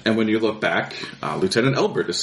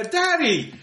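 put-on much higher child's voice calling out; little girl calling. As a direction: call for daddy like a small girl. Daughter runs in calling.

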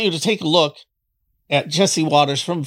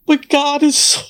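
fighting back tears; emotional; 11 semitones higher than usual. Real, not acted. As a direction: voice breaking up high. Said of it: "I'm, I'm emotional".

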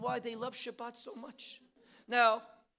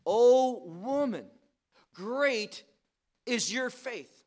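O woman slow and much louder, great is your faith firm; emphatic proclamation; 8 dB louder, slower than usual. Acted. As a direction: boom out O woman slowly, then land the verse. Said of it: "Verse twenty-eight".

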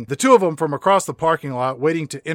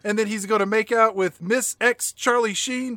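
mock yell; 6 semitones higher than usual. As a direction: shout at the screen to run. Continues yelling warning.

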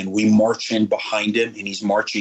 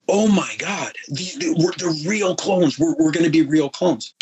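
awed; 6 semitones higher than usual. Retold or imitated. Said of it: "literally in tears".

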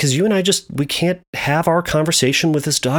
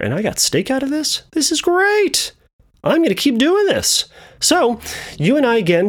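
delighted dog's thoughts; 13 semitones higher than usual. Acted. Dog gloating to himself over getting steak, delighted and high-pitched. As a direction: voice a thrilled dog, pitch soaring. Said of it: "the dog is thinking to himself".

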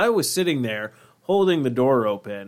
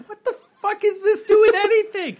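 squeaky, much higher, louder mock bafflement; mock confused listener; 6 dB louder than usual. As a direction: play a baffled viewer in a silly high cartoon voice. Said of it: "They're like".

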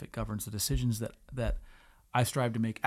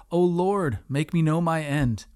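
scripture reading; 4 dB louder than usual. Acted.

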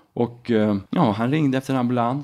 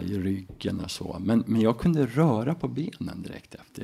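ordinary delivery

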